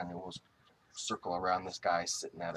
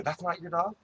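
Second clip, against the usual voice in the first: surprised question; 10 semitones higher, 8 dB louder than usual. Retold or imitated. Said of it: "I go".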